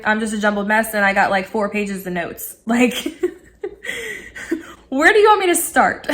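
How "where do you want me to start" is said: high, loud, laughing question; bursting with too much to say; overwhelmed excitement. Retold or imitated. Excited overload.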